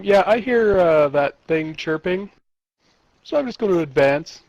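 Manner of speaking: ordinary delivery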